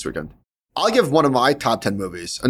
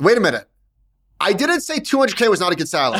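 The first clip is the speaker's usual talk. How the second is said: higher-pitched, defensive objection; indignant protest; 6 semitones higher than usual. Real, not acted. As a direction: protest your innocence, pitch jumping up. Protests at being teased about salary.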